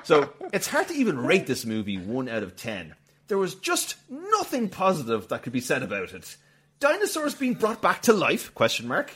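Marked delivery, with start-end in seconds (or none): 3.3-9.1 much higher, louder indignant rant; mock outraged review reading. Host reads a one-star review in mock outrage. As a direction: read it like a furious critic.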